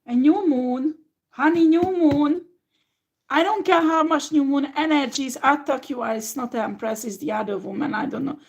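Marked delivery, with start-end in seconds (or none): 0.1-0.9 louder, insistent; emphatic. Real, not acted. Tarot reader urging a distressed client, loud and insistent. 1.3-6.0 louder and higher, almost shouting; fierce insistence. Real, not acted. Tarot reader defiantly reassuring a troubled client, high and loud.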